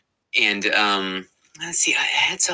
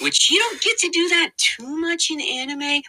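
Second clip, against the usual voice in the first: character voice. Shown as a demonstration. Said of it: "character voices like this".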